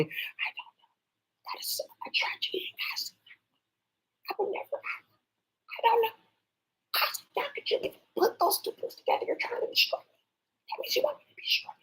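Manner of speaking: soft unvoiced whisper. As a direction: whisper as a cornered voice pleading. Whispered fearful other voice.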